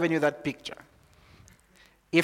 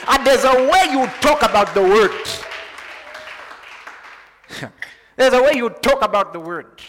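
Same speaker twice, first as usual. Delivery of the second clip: loud, high preaching, ringing out over the congregation; full-force preaching; 10 semitones higher, 11 dB louder than usual. Acted.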